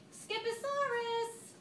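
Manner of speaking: high, loud and slow declaration; declared. A narrator's reading. Mama bursting into the room, loud and drawn out. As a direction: draw the name out like a grand announcement. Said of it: "declared Mama Junebug Jones".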